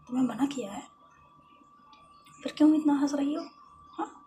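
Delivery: loud, high, stammering outburst; angry scolding. Acted. Jealous lover telling Lovely off for laughing, angry scolding. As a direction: cut her off and scold her loudly.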